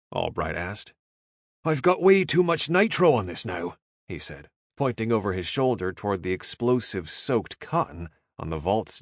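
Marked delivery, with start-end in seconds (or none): 1.6-3.8 higher, louder Irish brogue, alarmed; worried Irish. A narrator's reading. Caleb worried.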